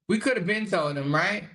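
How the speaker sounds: ordinary talk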